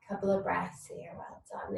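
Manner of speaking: soft unvoiced whisper; whispering a breathing cue to relaxed mums. Real, not acted. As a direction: breathe the words out gently.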